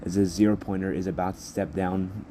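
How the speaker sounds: usual speaking voice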